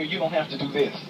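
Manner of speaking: normal talking voice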